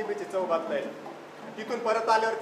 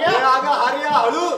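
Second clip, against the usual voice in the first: loud, raised call to slow down; calling after her; 5 semitones higher, 10 dB louder, slower than usual. Acted. Father calling after his running daughter, loud and urgent. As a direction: shout after her to be careful.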